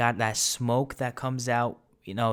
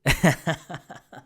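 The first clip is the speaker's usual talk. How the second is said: high chuckle at his own joke; laughing; 6 semitones higher, 4 dB louder than usual. Real, not acted. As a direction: burst of self-amused giggling.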